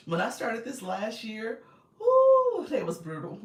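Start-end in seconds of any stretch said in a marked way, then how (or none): 2.0-2.7 whoo. Real, not acted. Exclaiming about brutal kids.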